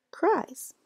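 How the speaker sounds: "cries" high, falling; bright reveal. Shown as a demonstration. Teacher reveals the new word to young pupils, high. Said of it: "change cry into cries".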